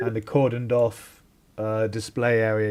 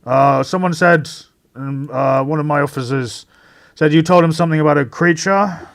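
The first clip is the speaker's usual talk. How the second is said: brisk, raised official voice, a bit higher; inspector's voice; 3 semitones higher, 5 dB louder than usual. Acted. Inspector briefing the players at the crime scene, brisk and official. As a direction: play the eager police inspector. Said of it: "Inspector Drummond steps forward and says".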